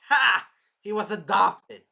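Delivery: loud high burst of mocking laughter, slow; hammed-up gloating shout. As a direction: shout the insult with a triumphant laugh. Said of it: "And he's like".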